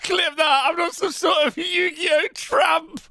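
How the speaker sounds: high, slow, shouted mock outrage; gleeful mock shout. Host gleefully shouting the cohost's tramp line back, mock outrage. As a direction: blast his words back at him, delighted.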